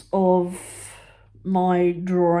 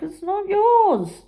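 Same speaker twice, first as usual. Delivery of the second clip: high, slow mock outrage; indignant protest; 12 semitones higher, much slower than usual. To mum, high, slow indignant protest. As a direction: drag out the protest. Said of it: "And I'm like".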